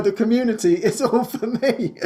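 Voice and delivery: high laughing retort. Real, not acted. Playfully correcting Francis, laughing. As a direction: tease Francis through laughter.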